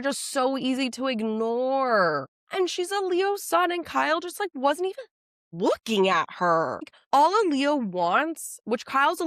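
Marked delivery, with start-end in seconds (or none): 2.5-6.8 indignant. Real, not acted. Indignant rant about Kyle.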